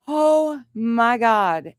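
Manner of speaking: high, drawn-out mock disbelief; exasperated dismay. Woman hears partner dismiss aggressive elk, exasperated dismay. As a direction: stretch it out, appalled at the recklessness.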